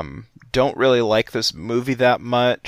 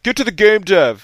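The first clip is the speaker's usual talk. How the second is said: high, louder mock heckle; impatient listeners; 8 semitones higher, 5 dB louder than usual. Host voices listeners demanding he get to the game, mock-impatient. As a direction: imitate an impatient audience calling out. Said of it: "everybody listening's like".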